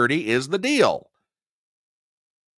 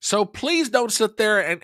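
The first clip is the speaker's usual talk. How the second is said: ordinary talk, nothing marked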